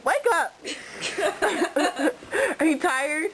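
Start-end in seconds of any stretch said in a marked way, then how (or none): 0.0-0.6 laughing. Real, not acted. Waking sleepy friend.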